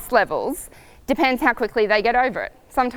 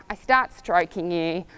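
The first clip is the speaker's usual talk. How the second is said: normal talking voice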